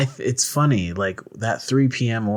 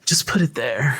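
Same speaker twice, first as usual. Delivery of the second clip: put-on breathy anime girl. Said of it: "act like anime characters".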